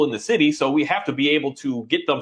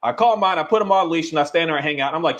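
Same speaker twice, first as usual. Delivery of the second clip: usual speaking voice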